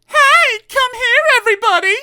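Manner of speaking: very high, loud call; shouting. Acted. Miss Trouble calling the crowd at the top of her voice. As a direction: bellow it to the whole town. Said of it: "at the top of her voice".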